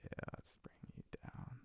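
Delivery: soft whisper to himself. Real, not acted. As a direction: whisper it while working. Adjusting levels while talking.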